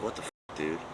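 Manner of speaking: hushed whisper, breath only. Retold or imitated. Whispering to Mike as the police pull in. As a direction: whisper it so the cops can't hear. He and Mike eye the arriving cops.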